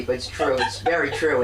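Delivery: normal talking voice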